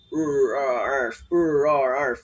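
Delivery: rolled growl rrr then arf; dog growl and bark. Shown as a demonstration. Teacher splitting a bark into sounds, growling. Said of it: "let's assume na may phoneme".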